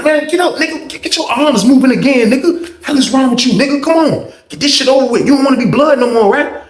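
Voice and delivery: high-pitched imitation of impatient urging; heated goading. Homeboy goading a reluctant fighter.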